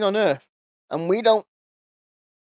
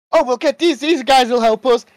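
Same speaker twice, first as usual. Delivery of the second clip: higher and louder, eager mock optimism; mock hopeful heroes; 7 semitones higher, 7 dB louder than usual. Mocking the heroes hoping the Egyptian gods will help, eager and high. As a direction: parody a naive hero full of hope.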